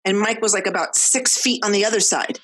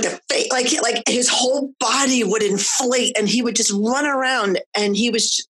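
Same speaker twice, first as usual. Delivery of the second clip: normal talking voice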